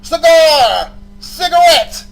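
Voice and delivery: loud, drawn-out repeated bellow; emphatic shouting. Real, not acted. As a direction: build to a high, stretched shout. Angry rant, repeating one word.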